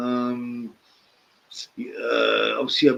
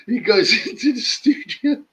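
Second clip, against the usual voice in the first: words broken by giggles; laughing while talking; 8 semitones higher, 3 dB louder than usual. Real, not acted. Telling the vegetable story through giggles.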